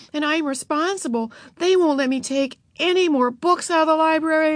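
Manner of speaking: put-on high, complaining girl's tirade; demanding. A narrator's reading. Beezus complaining to Mother about Ramona, high and aggrieved. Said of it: "Beezus demanded".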